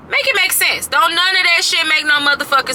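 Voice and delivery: high, loud, fed-up demand; high-pitched outburst of disbelief; exasperated shout. Real, not acted. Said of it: "So make it make sense".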